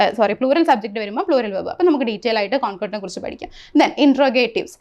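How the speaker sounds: ordinary delivery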